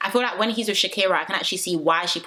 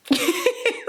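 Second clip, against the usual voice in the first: high, bursting real laugh; burst of laughter. Real, not acted. Laughing at Dejon's confession.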